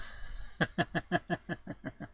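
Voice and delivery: soft, breathy run of chuckles; real giggling fit; delighted laugh. Real, not acted. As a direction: laugh quietly in short, voiceless bursts. Laughing after finding the word.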